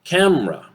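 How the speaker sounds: CAMERA as CAM-ra, high then falling; reduced vowel. Shown as a demonstration. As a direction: model two-syllable CAMERA. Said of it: "Repeat it after me".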